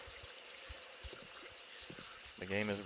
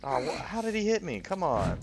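raging gamer; 5 semitones higher than usual. Real, not acted. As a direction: lose it at the game. Raging after being hit.

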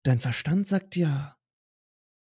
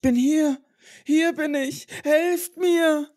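a captive woman crying out for rescue; desperate cry for help; 13 semitones higher than usual. Acted. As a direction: shout to be found, voice high and strained.

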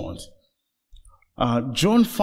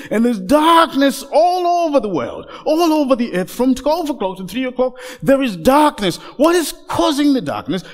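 usual speaking voice

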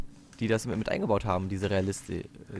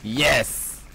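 triumphant; 5 dB louder than usual. Real, not acted. Success in game.

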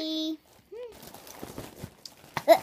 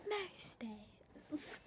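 whispering; 14 dB quieter than usual. Real, not acted. Echoing friend in whisper.

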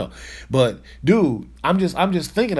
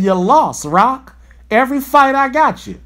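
quoting Bruce Leroy's rival; 8 semitones higher, 6 dB louder than usual. Retold or imitated. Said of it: "he said".

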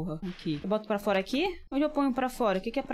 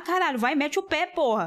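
urgent; 7 semitones higher, 3 dB louder than usual. Real, not acted. Urging character to flee.

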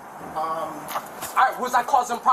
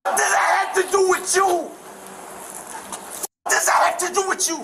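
angry shouting; 7 semitones higher than usual. Real, not acted. Arrested man yelling at cop.

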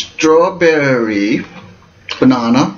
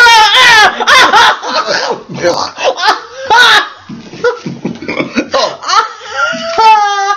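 loud laughing fit; 4 dB louder than usual. Real, not acted. Just tasted the bad bean.

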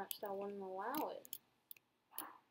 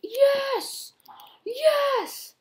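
high, loud cheer of victory; triumphant shout; 12 dB louder than usual. Real, not acted. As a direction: yell it in triumph. Celebrating a success in game.

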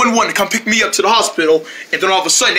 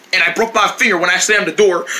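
plain reading voice

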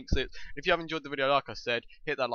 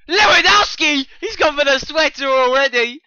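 high-pitched celebratory scream; real goal-scoring shriek; excited shout; 16 dB louder than usual. Real, not acted. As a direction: yell it at the top of your voice.

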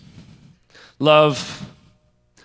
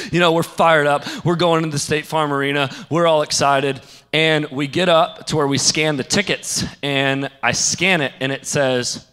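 usual speaking voice

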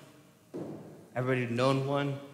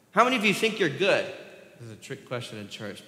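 ordinary delivery

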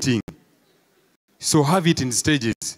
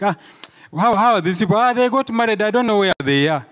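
high, wondering gossip voice; a hint of nosy neighbours asking around; 8 semitones higher than usual. Gossips wondering where couple went.

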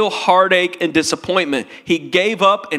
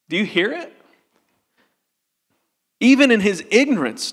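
normal talking voice

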